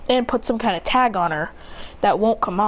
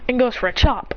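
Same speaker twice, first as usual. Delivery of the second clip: ordinary talk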